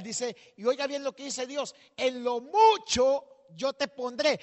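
predicando a todo pulmón, fuerte y agudo; predicación encendida. Acted. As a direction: clamar con fervor ante la congregación. Preaches to the congregation. (predicador que exhorta a la congregación con fuerza)

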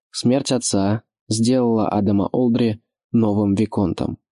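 ordinary delivery

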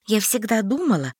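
plain reading voice

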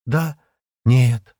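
hesitant answer with a pause between words; answer, then doubt it; uncertain. A narrator's reading. Шира answers whether she feels her arm, uncertain. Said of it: "неуверенно ответила Шира".

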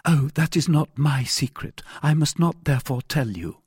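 lightly voiced woman, pitch lifted; discreet heroine. A narrator's reading. Wife refuses her rescuer the secret, mildly firm.

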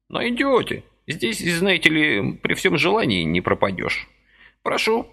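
put-on high, chirpy old man's voice; lively old man pressing a deal; brisk old man. A narrator's reading. Lively old man persuading Vasily, high chirpy voice.

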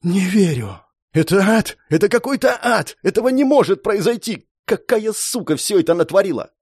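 high-pitched cry of disbelief; anguished outburst. A narrator's reading. Hero learns friends died, high anguished raging.

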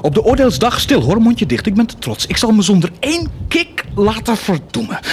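high, loud defiance; defiant boast. Acted. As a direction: fling the pride at heaven.

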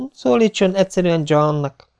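lightly voiced young woman, high pitch; young woman interrupting. A narrator's reading. Young woman interrupting the officer's courtesy, lightly feminine voice. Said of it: "vágott közbe a lány".

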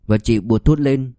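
plain reading voice

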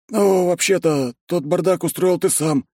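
high excited cheerful voice; Ben's voice. Acted. Ben answers in his voice.

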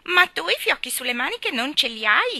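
put-on high, loud child's shriek. A narrator's reading. Geraldine taunts Ivy about her sleeve bows, shrieking. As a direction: shriek the question at another child. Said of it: "strillò Geraldine".

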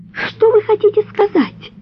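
high, moaning plea. A narrator's reading. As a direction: moan the question weakly. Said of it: "простонала она".

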